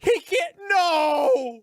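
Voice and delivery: very high loud crying no; anguished cry. Real, not acted. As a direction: howl it in comic despair. Shock at the death.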